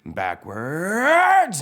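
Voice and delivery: high, loud word rising into a yell; Mater. Acted. Mater tests reverse and takes off, loud high holler. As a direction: stretch it into a startled holler. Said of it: "Mater says".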